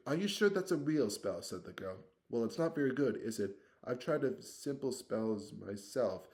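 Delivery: bossy Hermione. Acted. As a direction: rattle it off, proud and bossy. Hermione voiced.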